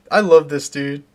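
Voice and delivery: normal talking voice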